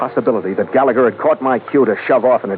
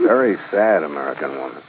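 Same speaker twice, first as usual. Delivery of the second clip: dry, lower, softer sarcastic repeat; mocking echo; 7 semitones lower, 4 dB quieter than usual. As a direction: echo his words with scorn. Echoing the colonel mockingly.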